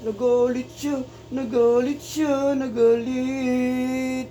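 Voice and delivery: drawn-out teasing. Acted. Owner teasing the pet dog.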